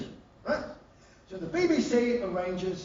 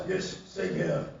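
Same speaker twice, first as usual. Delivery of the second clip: much lower, dry royal reply; dry King Charles; 12 semitones lower, slower than usual. Retold or imitated. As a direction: deliver it bone dry, half laughing. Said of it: "Charles replies".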